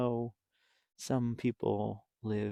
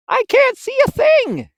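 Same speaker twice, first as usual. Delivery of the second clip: far higher, loud panicked yell; 15 dB louder than usual. Acted. Pip yelling while flying in the dark. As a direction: cry out blind in the dark. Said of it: "yelled Pip".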